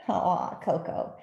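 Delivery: ordinary delivery, nothing marked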